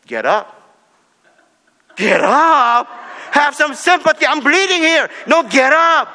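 loud, high command to get up; stern dad. Retold or imitated. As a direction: bark at the fallen kid, half-hammed. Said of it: "No, he said".

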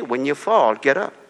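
ordinary talk, nothing marked

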